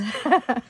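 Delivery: brief self-deprecating chuckle; small real laugh after admitting trouble; short laugh. Real, not acted. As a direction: laugh lightly at your own mistake. Laughs at own trouble with names.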